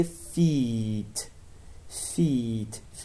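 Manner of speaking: FEET with a long, stretched EE; drawn-out vowel. Shown as a demonstration. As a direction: hold the EE in FEET before the T. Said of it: "باید کشیده تلفظ بکنیم". (تلفظ کشیده FEET با «ای» بلند)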